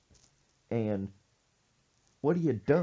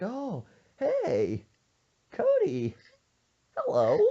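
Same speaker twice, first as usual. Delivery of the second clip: high, bright, guilty mock-innocent hello; overly innocent squeaky hello; fake casual greeting; 11 semitones higher, 7 dB louder, much slower than usual. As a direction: sell a nervous fake-friendly greeting, much higher. Caught hiding, fake casual greeting.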